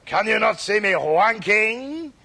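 put-on slow French-accented question; cod French accent. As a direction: pose it as a badly faked Frenchman. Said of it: "a man came on dressed as a Frenchman".

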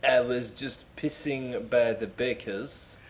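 put-on slow, mangled French-accented English; bad French accent. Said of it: "what would he say?".

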